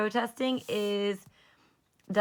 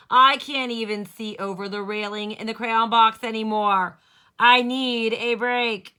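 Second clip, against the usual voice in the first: louder, pleading crayon voice; complaining crayon letter; 10 dB louder than usual. Acted. Teacher reading a weary crayon's letter, complaining loudly. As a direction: read it as a worn-out crayon.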